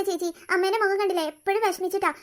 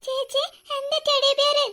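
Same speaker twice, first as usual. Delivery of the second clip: put-on high, slow, childlike delight over എന്റെ teddy bear; small boy overjoyed to hold his teddy again; delighted child; 7 semitones higher, slower than usual. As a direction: squeal it like a happy little boy. Child gets his teddy back.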